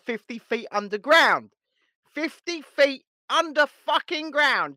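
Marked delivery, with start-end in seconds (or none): 2.1-4.8 mock shout. Mocking co-host in his bunker.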